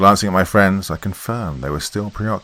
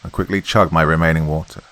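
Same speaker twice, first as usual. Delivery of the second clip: ordinary delivery, nothing marked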